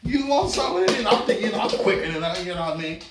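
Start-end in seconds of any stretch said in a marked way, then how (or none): 0.0-1.1 high, showy Harlem Nights taunt; Eddie Murphy bravado. Retold or imitated. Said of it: "I'm Eddie Murphy over there".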